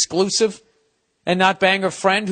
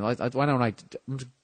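stuttering; 5 semitones lower, 7 dB quieter, faster than usual. Real, not acted. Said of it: "look at me, I'm stuttering here".

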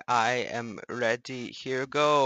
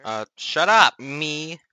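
snapping at himself, loud; bickering voices in one; 9 dB louder than usual. Pretend. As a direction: tell yourself to shut up. Arguing with himself.

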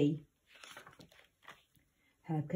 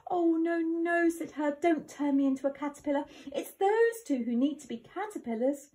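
high, quick begging; panicked pleading boy; 8 semitones higher than usual. Acted. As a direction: plead not to be turned.

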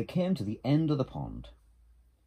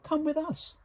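very high, louder invitation; high storybook beaver, beckoning; little beaver; 4 dB louder than usual. A narrator's reading. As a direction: invite along in a high animal voice. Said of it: "said Little Beaver and the duck".

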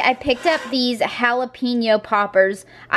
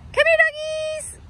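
pet voice. Real, not acted. Owner asks her dog Cooper what he is up to, in much higher baby talk. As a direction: coo up high at the dog.

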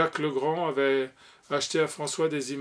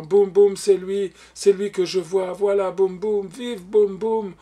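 high, loud, jubilant cheering; gleeful shouts, stretched out at the end; seven-year-old's glee; 4 semitones higher, 8 dB louder, slower than usual. A narrator's reading. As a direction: cheer like an overjoyed little boy. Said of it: "dit avec sa gaieté de sept ans".

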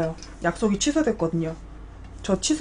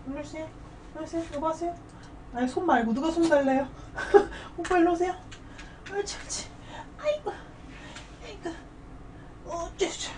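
squeaky praising a dog; 6 semitones higher, 11 dB quieter, faster than usual. Real, not acted. Coaxing dog to come.

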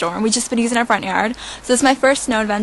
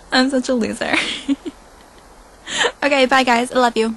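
ordinary talk

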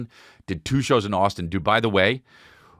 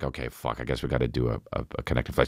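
low and much softer, defeated; resigned mutter; 10 semitones lower, 6 dB quieter, faster than usual. Retold or imitated. Comic recalling giving in to the flight, muttered low and soft. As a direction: sink into a quiet grumble.

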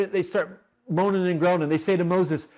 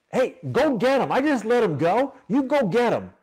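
higher, urgent command; Pharaoh ordering troops; 7 semitones higher, 4 dB louder than usual. Acted. Pharaoh sending his guard after the Israelites, higher urgent order. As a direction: shout the order to chase them.

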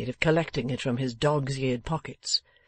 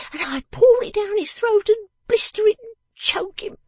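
very high, vehement little voice; vengeful little Jenny; 6 dB louder than usual. Acted. Jenny tells Lizzie how she would punish him, vengeful. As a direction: relish the revenge in a high, loud voice. Jenny imagines her revenge.

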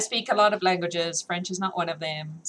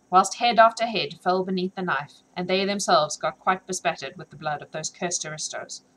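usual speaking voice

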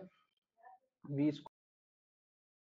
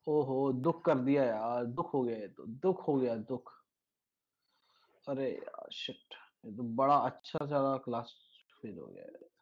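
mock lament; 6 semitones lower, 5 dB quieter, much faster than usual. Teacher laments class failing.

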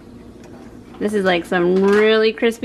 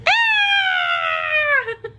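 sudden high fake shriek; high mock scream. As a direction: squeal it like a scared kid. Mock scream after spooky quote.

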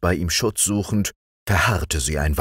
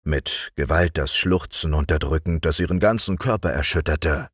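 plain reading voice

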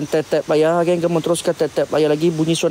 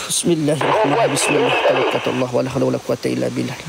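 murmured prayer; 4 semitones lower, faster than usual. Real, not acted.

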